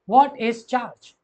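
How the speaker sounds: each word drawn out slowly for notes; slow dictation. Shown as a demonstration. Teacher to students, dictating slowly. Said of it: "teacher slowly dictating the question".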